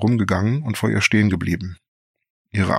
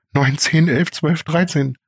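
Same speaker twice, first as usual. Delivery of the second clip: frantic counting; 8 semitones higher, 3 dB louder, slower than usual. Acted. Bells ring, frantic counting.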